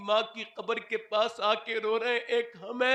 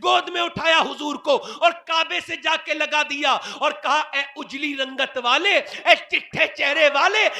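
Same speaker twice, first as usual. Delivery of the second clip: fiery preaching; 5 semitones higher, 9 dB louder than usual. Acted. Preaching at full force.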